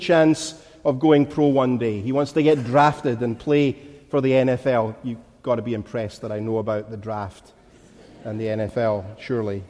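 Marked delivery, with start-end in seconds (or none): 5.0-9.6 low, soft mock boast; wry aside. Real, not acted. Preacher joking that he knows the draft, wry low aside. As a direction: drop down for a dry, throwaway joke.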